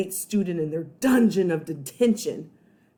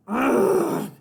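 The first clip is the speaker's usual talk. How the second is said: groan; 4 semitones higher than usual. Acted. Boy groans at the school bell, dismayed. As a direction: cry out in dismay. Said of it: "Eugene cried out".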